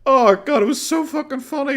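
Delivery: high and loud, still giggling; catching breath between laughs; real leftover laughter; laughing. Real, not acted.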